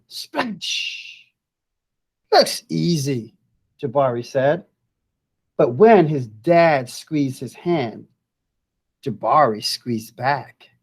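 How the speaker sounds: lively storybook voice with big rises; reading to children. Acted. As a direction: read it like a picture book to kids. Teacher reads picture book aloud.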